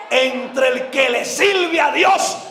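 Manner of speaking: slow, high preaching shout; proclama a gritos. Real, not acted. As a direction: hurl each word out to the congregation. Proclama el lema del ministerio. (proclamación a gritos, lenta y aguda)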